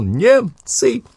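high alarmed shout; enemy spotted shout. Acted. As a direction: yell the warning in alarm. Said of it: "и вдруг громко закричал". (тревожный крик)

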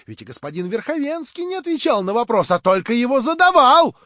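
high, loud accusation building up; shouted objection. A narrator's reading. Lame man exposes Verkhovensky to the gathering, shouting. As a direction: drive it up to a shout. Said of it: "кричал Хромой".

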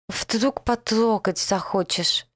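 slow, lisping after a tongue bite. Acted. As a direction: lisp every hiss, slowly, with a bitten tongue. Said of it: "прошепелявил он".

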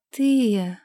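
a disappointed exhaled word. A narrator's reading. Princess finds the wrong man at her door, disappointed. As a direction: breathe it out, let down. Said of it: "разочарованно выдохнула Лилейна".

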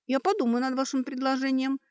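high, held, uncertain reply; bewildered. A narrator's reading. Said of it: "растерянно склонила голову Сашка". (растерянно, высоким голосом)

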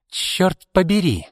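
high, drawn-out angry curse; exploding in anger. A narrator's reading. Izolda curses when Arkady refuses the shares, exploding. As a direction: burst out, slow and high. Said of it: "взорвалась Изольда".

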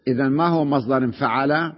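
normal talking voice

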